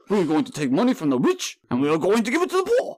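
stump-speech voice climbing high, Dracula-like; botched politician accent, rising into a high pitch; Bernie Sanders impression.

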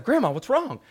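put-on high-pitched startled kid; imitating a taken-aback little kid; shocked and taken aback. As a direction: ask grandma in high, hurt surprise. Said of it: "shocked and, like, taken aback".

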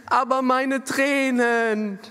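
put-on high, slow whimpering little boy; whiny child. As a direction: mimic a crying child's complaint. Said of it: "Und dann sagt er".

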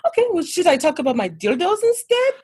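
high-pitched, put-out Swedish lady; Swedish accent. Retold or imitated. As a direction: complain in a hammed-up Swedish accent. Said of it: "try to do a Swedish accent".

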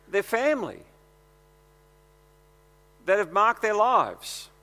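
preaching. Acted. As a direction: drive it home from the pulpit. Rising into preaching.

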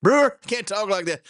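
high squeaky scandalized voice; mock shocked friend. Friend scolding Brewer about the balls joke, high and squeaky. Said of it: "He said".

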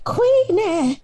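high-pitched mimicking call; exaggerated auntie calling out a name; Aunt Song. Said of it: "And Ansong, I'm just like".

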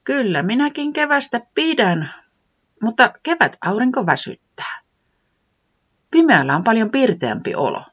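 high character voice explaining; high weatherman voice. Acted. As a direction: gently explain his preference. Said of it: "säämies selitti".

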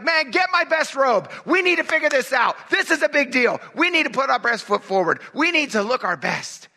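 a light mock panic before the big visitors; flustered Jesus. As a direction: fuss about the robe like a nervous host. Jesus imagined fussing over guests.

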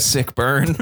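normal talking voice

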